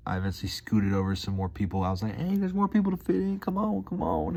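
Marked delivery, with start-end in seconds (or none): 2.2-4.4 high, eager come-on; friendly invite. Retold or imitated. A lone diner inviting strangers at the bar, high and eager. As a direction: wave strangers over brightly. Inviting people to scoot over.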